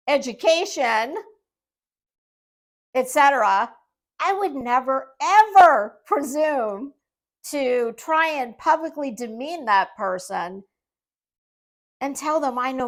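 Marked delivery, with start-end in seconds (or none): none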